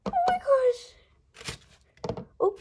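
high, slow, drawn-out squeal of delight; near-tearful high gush; overwhelmed squeal. Real, not acted. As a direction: melt over the toy, squealing. Overwhelmed by a new toy.

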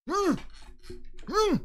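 tense high-pitched hum; high scared whimper. Real, not acted. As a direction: whimper through closed lips. Tense waiting in game.

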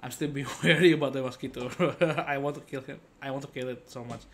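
usual speaking voice

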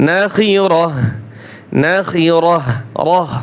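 নাখিরহ repeated slowly for learners, each ending on a breathed h; pausal h ending. Shown as a demonstration. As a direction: stop on নাখিরহ with h, then isolate রহ. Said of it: "এভাবে". (নাখিরহ, রহ, ওয়াকফে শেষে "হা" উচ্চারণ)

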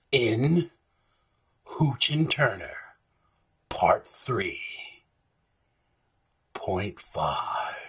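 low, slow trailer narrator; movie trailer voice. Acted. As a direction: drag it out like a portentous trailer monologue. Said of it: "should I read it in the movie trailer voice".

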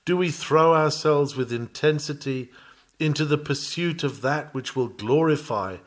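ordinary delivery

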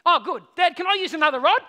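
put-on loud, high, eager child asking; instantly cheered-up kid. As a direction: bounce straight back, carefree. Son instantly cheerful after forgiveness.

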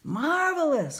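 high, loud burst of delight; delighted cry. A narrator's reading. Carpenter hears he will earn money, delighted cry. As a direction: cry it out, thrilled by the news. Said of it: "cried the carpenter".

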